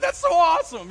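high, loud gleeful admiration; rebel's delight. Retold or imitated. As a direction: cheer it like a thrilled fan. Preacher delighted by retold mohawk.

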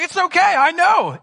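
reassuring friend. Retold or imitated. Jesus comforting his best friend, reassuring. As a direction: clap him on the back with it.

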